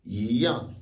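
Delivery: "yan" as yi yang, yi rising then yang falling; second-tone yi. Shown as a demonstration. Teacher shows fourth-tone case, rising yi at start of "yan". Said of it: "le i devient automatiquement deuxième ton".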